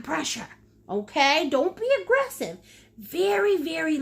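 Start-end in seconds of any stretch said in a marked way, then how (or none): none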